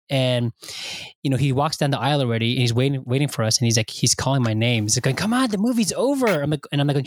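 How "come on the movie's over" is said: lightly voiced impatient kid, higher; a child tugging at the adult to leave. Five-year-old calls out after movie.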